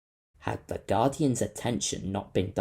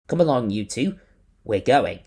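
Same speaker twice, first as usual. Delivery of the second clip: firm order; 3 semitones higher, 9 dB louder than usual. A narrator's reading. Captain orders crew.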